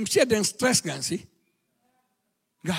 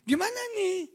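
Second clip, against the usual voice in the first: wife asking; 9 semitones higher, 5 dB quieter than usual. Retold or imitated. Wife voiced asking husband's opinion.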